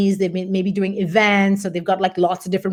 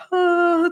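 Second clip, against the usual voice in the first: panicked gasp; 10 semitones higher than usual. Pretend. Evoking free-fall panic.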